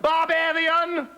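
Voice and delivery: high, slow, indignant frog cry; outraged frog. Acted. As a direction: hurl the insult up.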